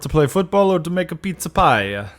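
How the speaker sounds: high, loud hammed-up Italian accent; mock Italian. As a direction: join the joke with a cartoon Italian voice. Cohost joins Italian joke.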